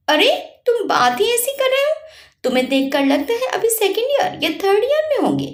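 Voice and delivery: amazed he's finished college; shocked. Acted. Heroine amazed at Virat's age, says it in surprise. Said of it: "Rajnandini शौक में बोली".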